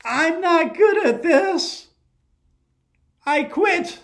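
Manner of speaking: put-on a child giving up in a huff; frustrated girl quitting. Frustrated girl quits in story.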